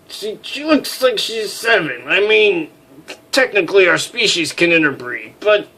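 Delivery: protest, caught off guard; flustered high voice. Acted. Flustered character denial.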